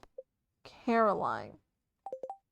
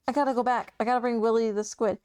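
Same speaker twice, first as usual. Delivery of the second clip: ordinary talk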